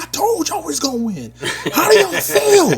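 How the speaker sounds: high, laughing gloat; retold victory taunt. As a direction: rub the win in their faces. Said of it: "I talked so much shit".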